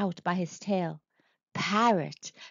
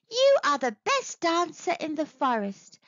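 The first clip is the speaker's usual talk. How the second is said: higher flattering monkey voice; calling out; 7 semitones higher than usual. A narrator's reading. Clever Monkey luring the monster, calling out. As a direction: flatter him in a high call. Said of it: "she called".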